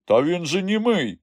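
high, urgent cry; protesting shout. A narrator's reading. Ivan stopping traders beating a boy, protesting shout. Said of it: "крикнув Іван".

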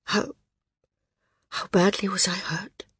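soft, frightened half-whisper; barely able to get the words out. A narrator's reading. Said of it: "The words came in a whisper".

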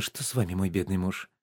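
soft, falsely tender cooing; cooing wife. Acted. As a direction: coo over him like a worried wife. Wife cooing over sick husband. (жена нежно воркует над больным мужем)

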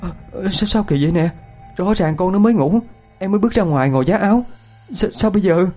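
high-pitched frightened mother; panicked stammering. Acted. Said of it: "Chị cứ lắp bắp mãi".

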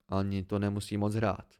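ordinary delivery, nothing marked

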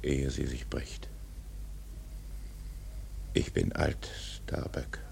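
unvoiced, fading whisper; old captain whispering his weariness. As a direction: let the words sink to a breath. Ahab confides near the end.

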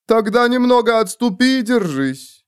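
plain reading voice

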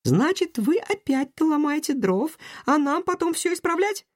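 put-on high girl voice, sulky; frowning girl. A narrator's reading. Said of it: "Салли нахмурилась".

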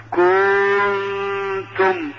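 exaggerated, very slow hum on the ن in كنتم; overdone nasal hold. Shown as a demonstration. Sheikh warns readers against exaggeration, overstretched ن in كنتم. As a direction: stretch the ن of كنتم very long. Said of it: "هذا خطأ".

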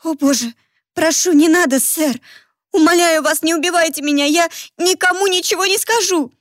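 high, desperate, near sobbing; tearful pleading. Acted. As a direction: beg for your life in tears. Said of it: "По щекам покатились слёзы".

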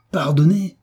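exclamation outrée, nettement plus haute; hors de lui. A narrator's reading. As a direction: renvoie le mot comme un affront. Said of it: "s'écria Savelli hors de lui". (s'écrier hors de soi)

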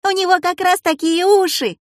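put-on very high, excited girl's voice; excited call-out. A narrator's reading. Classmate Людка backing up her guess, excited call-out. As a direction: blurt out the proof in a high voice. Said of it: "выкрикнула Людка Пустякова".

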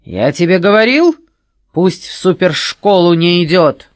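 high, loud menacing interrogation; sinister voice. A narrator's reading. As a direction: list his failures ominously. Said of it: "зловещим голосом".

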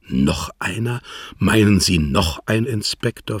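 ordinary delivery, nothing marked

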